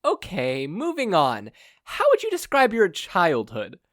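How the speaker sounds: polite captive mother. Acted. Blindfolded mother politely interrupts her kidnapper. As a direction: interrupt with mock courtesy. Inko steering conversation.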